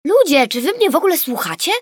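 high, loud indignant cry; outraged that nobody listens. A narrator's reading. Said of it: "oburzyła się Gabrysia". (oburzony okrzyk)

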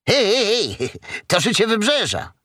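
high-pitched, lively fox voice; excited little fox. Acted. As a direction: pipe up as an eager little fox. Fox exclaims over a newspaper.

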